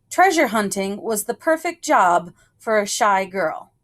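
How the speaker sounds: plain reading voice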